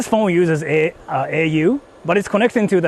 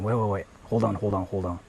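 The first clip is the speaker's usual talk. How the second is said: quiet muttering; 8 semitones lower, 9 dB quieter than usual. Real, not acted. Mutters while checking the stream.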